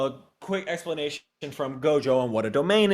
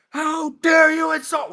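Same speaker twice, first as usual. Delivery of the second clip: very high, slow hammed-up shout; outraged villain yell; mock enraged shriek; furious Jogo; 5 dB louder than usual. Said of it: "Jogo's like".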